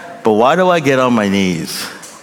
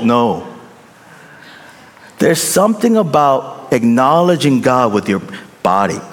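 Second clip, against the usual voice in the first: ordinary delivery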